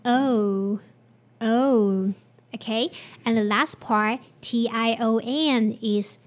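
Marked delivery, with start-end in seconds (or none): 0.0-2.1 "O" with tense rounded lips pulled tighter. Shown as a demonstration. Teacher drilling the O sound with rounded lips. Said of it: "Let's try it again".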